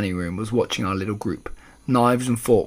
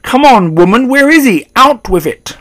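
high, very loud demand; furious shout; 11 semitones higher, 13 dB louder than usual. A narrator's reading. Father demanding answers from grandmother, shouting angrily. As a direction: shout it impatiently at full voice.

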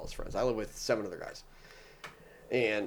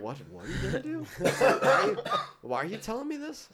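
much higher, laughing what; high laughing disbelief; 13 semitones higher, 5 dB louder than usual. Retold or imitated. Roommate hearing about blasting off, high laughing disbelief. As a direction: squeak out shocked questions.

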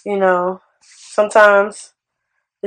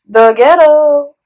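drawn-out, higher catchphrase; Nene Leakes; 6 semitones higher, 4 dB louder, much slower than usual. Acted. Host jokingly quoting a reality star, drawn-out impression. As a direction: stretch it slowly into a sassy reality-star exclamation. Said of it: "In Nene Leakes' voice".

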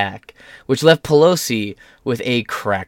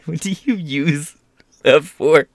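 slow, higher mock-baffled ask; mock puzzled; 5 semitones higher, slower than usual. Holding up the toy, asking in mock puzzlement. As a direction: feign innocent confusion.

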